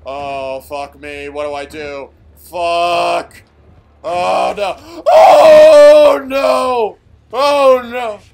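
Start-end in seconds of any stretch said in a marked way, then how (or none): 2.4-8.2 desperate screaming; terrified scream. Real, not acted. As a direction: scream as it grabs you. Monster catching him.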